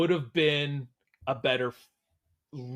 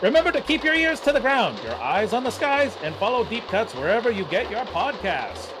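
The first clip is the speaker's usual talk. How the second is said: put-on old newsreel; 7 semitones higher than usual. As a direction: boom it out like a 1940s broadcast. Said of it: "in the style of, like, a nineteen forties newsreel".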